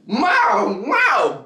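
high mock impact noises; punch sound effect. Imitating punches.

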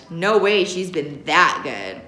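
plain reading voice

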